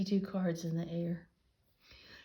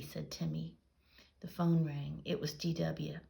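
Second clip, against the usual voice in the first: usual speaking voice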